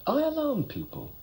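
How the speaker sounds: high-pitched disbelieving echo; incredulous echo. Acted. Airman told by his friend he alarms people, incredulous. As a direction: throw it back, pitched right up in astonishment.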